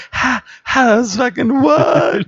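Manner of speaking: high, slow panicky disbelief; mock freaked-out. Retelling the game scare, high panicked disbelief. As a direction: act spooked and unable to believe it. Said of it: "And I was like".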